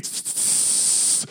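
imitated burst of steam; long steam hiss. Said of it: "it's like".

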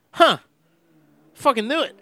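high, knowing "huh, fucking knew it"; a smug little told-you-so gasp; told-you-so realization. Retold or imitated. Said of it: "and I'm just all like".

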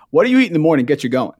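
rattled off fast, falling at the end; fast recap of a stock question; quick repeat. Real, not acted. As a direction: rush through the familiar question.

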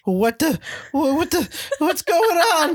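high, cracking confused voice; Morty voice. Real, not acted. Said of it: "You sounded like Morty for a second".

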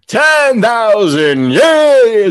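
genie. Acted. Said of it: "he just yells".

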